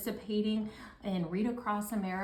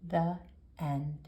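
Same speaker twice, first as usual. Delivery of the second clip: lively picture-book read-aloud, louder than her chat; reading to children; 6 dB louder than usual. Acted. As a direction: read it out to the class like story time. Teacher reads picture book to class.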